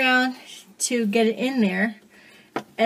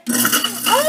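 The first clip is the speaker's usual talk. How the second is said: excited gasp; 10 semitones higher, 4 dB louder than usual. Real, not acted. Fixed sink, celebrating.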